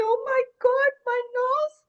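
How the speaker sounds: high, loud amazed squeal; astonished. Acted. Boo Boo discovering her long nose, astonished.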